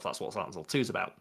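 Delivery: ordinary talk, nothing marked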